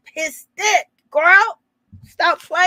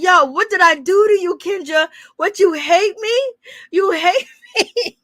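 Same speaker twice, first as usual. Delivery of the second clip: higher, slowing, laughing mock whine; mock hurt Jock; 4 semitones higher than usual. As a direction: play Jock wounded and pleading, cracking up. Jock voiced, whining at Kendra.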